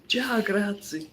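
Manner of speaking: slow, drawn-out Italian ciao grazie; mock Italian accent. Acting the Italian goodbye with head wobble, mock Italian accent. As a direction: ham it up like an Italian local. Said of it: "wobble your head and do that walk".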